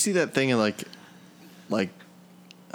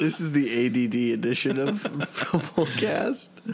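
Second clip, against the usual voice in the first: mock announcer; 4 semitones higher, slower than usual. Joking announcer line.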